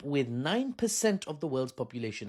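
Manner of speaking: ordinary talk, nothing marked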